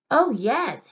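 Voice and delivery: sighing yes. Acted. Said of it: "Henrietta sighed".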